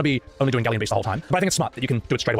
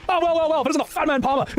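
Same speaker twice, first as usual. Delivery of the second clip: much higher mock rage at Shira; much higher hammed-up rant; raging Sid; 13 semitones higher than usual. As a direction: ham up a furious hot-headed pilot. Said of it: "Sid's like".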